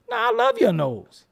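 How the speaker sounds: high-pitched, loud gushing; acted-out compliment, squealed high; admiring protest. Voices an admirer contradicting him.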